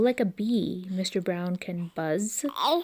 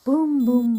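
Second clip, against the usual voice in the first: loud, higher booms; thunder booms; 4 semitones higher, 7 dB louder than usual. A narrator's reading. Reading to a child, booming like thunder. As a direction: boom like thunder. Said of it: "Mister Brown makes thunder".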